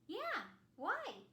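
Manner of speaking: high silly voice; cheerful dopey reply; goofy snowman. Acted. Snowman answering Anna, goofy voice.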